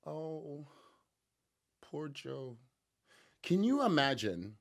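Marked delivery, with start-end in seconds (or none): 0.0-2.6 sarcastic pity. Pretend.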